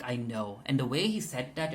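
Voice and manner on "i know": low, quiet, menacing; ominous. Retold or imitated. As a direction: say it like a quiet threat. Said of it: "it was so ominous".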